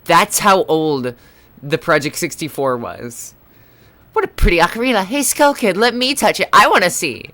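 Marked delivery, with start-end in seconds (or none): 4.1-7.3 fairy voice. Acted. Reads a fairy's lines aloud.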